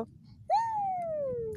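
long, very high sniff noise; thin squeaky inhaling hum; imitated baby sniffing. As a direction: mimic a toddler savouring a smell. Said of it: "puxou minha mão de novo e ficou".

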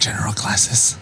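whispered teasing nickname. Real, not acted. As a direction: breathe the name with no voice. Teasing aside after the story.

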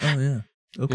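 light English accent; a quick English accent. Shown as a demonstration. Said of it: "Say that in an English accent".

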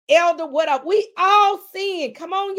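ordinary delivery

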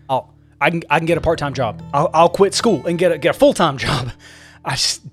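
stammering, panicked begging; desperate pleading. Acted. Teen begs guardian to keep him, desperate pleading. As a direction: beg for your life as you know it.

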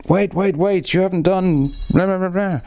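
high-pitched mock objection from a know-it-all; nitpicking expert. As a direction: squeal it like a fussy pedant. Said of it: "and you're now going".

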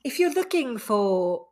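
slow, stretched British ad read; British accent. Acted. Co-host improvising a Kohl's commercial, British accent. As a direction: try a drawn-out British commercial voice. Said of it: "do a commercial for Kohl's".

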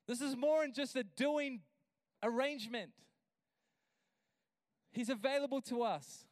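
full-force preaching. Acted. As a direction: preach it with rising fire.

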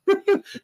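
high, short chuckle; brief high-pitched giggle; laugh. Real, not acted. After griping that his wife won, laughing.

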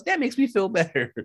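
high, put-on voice; acted comforted girl. Upset host telling the student she feels better, high put-on voice. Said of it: "and she just goes".

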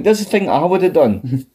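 ordinary talk, nothing marked

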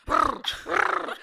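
a quick imitated horse brr, high and loud; horse snort. Horse stamping, imitated noise.

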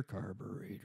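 very soft, low deadpan; barely voiced, low, stubborn answer; mechanic repeating himself. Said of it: "he just keeps saying".

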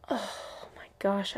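slow, soft, shaky oh my gosh; shaky sigh. Real, not acted. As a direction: sigh it out, fighting tears. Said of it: "I'm trying not to cry".

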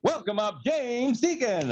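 high, lively host voice calling someone to stage; imitating a noontime TV host announcing a guest; game show host. Hosting the live draw.

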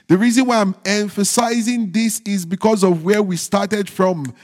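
ordinary talk

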